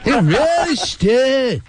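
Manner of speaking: exaggerated high, slow slur; mock slurring drawl. Host mimics [name]'s daughter talk, high slurred drawl. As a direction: smear and stretch every word in mockery. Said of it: "talks like she's [name]'s daughter".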